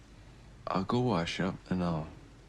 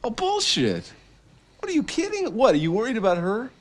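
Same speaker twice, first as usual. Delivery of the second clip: much higher and louder, incredulous rage; furious yelling; 9 dB louder than usual. Acted. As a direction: scream it at him in disbelief. Angry outburst at brother.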